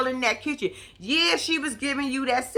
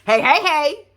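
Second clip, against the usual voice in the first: a pretend louder burst of "Hey, hey, hey"; mock burst-in; 5 semitones higher, 6 dB louder than usual. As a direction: play Tiffany busting in, hammed-up. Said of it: "Tiffany shoulda came out like".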